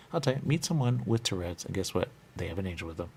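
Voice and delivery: ordinary delivery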